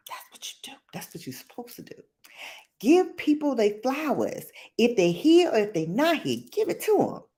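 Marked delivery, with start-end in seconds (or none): 0.1-1.9 unvoiced whispered aside, second line fast. Real, not acted. 6.5-7.2 pitched way up, pushing the point; emphatic urging. Real, not acted.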